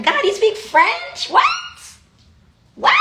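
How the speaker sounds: high, slow incredulous question; mock disbelief. Speaker playing herself stunned at God speaking French, high and slow.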